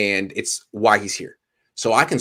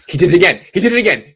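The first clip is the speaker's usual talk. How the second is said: mock announcer call; 9 semitones higher, 10 dB louder, faster than usual. Echoing a hyped play call.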